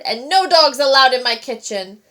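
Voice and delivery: loud, stress on no; angry roar. A narrator's reading. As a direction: boom out the rule. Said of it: "he roared".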